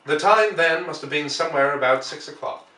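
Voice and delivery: ordinary delivery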